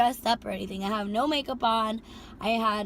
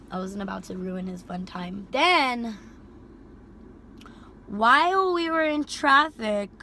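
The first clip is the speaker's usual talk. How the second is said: ordinary delivery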